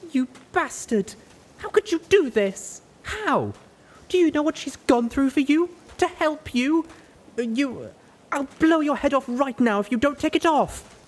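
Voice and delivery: loud, high tearful shout; shouting through tears. A narrator's reading. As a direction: yell at him, near crying. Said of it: "she shouted as she started to cry".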